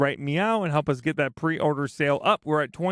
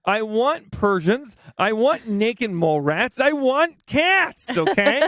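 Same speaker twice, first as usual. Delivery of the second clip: slow, emphatic mock outrage, higher; mock demanding rant; 5 semitones higher, 5 dB louder than usual. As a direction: drag out each demand in playful exasperation. Mock demand for cat pictures.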